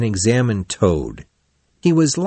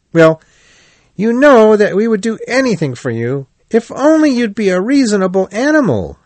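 high, loud pleading friend; exasperated Rat; 10 semitones higher, 4 dB louder than usual. A narrator's reading. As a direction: protest in a lifted, insistent voice.

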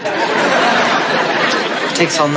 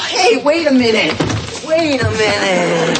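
urgent call; 5 semitones higher than usual. Acted. Desperately stopping family leaving.

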